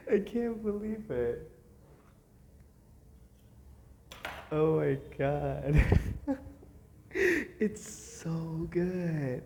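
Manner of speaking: high, loud, slow disbelief; overwhelmed with delight; amazed excited. Real, not acted. Amazed at coat.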